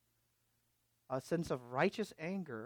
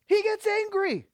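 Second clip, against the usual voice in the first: much higher, louder cry; emphatic exclamation; 11 dB louder than usual. Acted. Preacher stunning the church with Jesus' anger, high and loud. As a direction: land it like a revelation.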